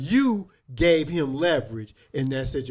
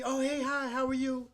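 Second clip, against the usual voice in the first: high, softer mock-girlish greeting; imitated friendly reply; 10 semitones higher, 6 dB quieter than usual. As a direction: imitate her chirping back in a high voice. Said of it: "ain't like her tone, how she said it".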